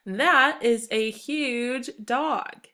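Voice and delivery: slow, wide-eyed exclaim; excited exclamation. Shown as a demonstration. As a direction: stretch it out like you're amazed. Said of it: "an exclamation mark shows feeling or excitement".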